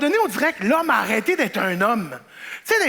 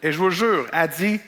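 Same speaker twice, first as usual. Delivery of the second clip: ordinary talk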